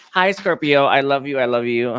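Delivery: shower the audience with affection; gushing greeting. Real, not acted. Greeting Scorpio viewers warmly.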